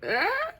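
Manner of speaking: short high-pitched mock yelp; sudden high comic cry; comic squawk. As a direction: let out a silly high "ah". Comic outburst after "stay comedic.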